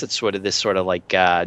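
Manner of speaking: ordinary delivery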